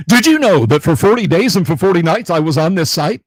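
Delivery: loud, high-pitched Shorts narrator; YouTube Shorts voice. Shown as a demonstration. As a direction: belt it out like an over-hyped short-video host. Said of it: "in my YouTube Shorts voice".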